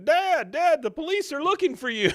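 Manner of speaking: high, loud panicked yell; frantic high scream; screaming kid. Retold or imitated. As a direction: shriek it like a scared boy. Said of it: "Bobby's over there screaming".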